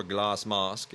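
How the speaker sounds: mock-British with long broad A in "Glass Mask"; fake British accent. Reviewer playfully repeats the anime title, fake British accent. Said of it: "with my fake British accent".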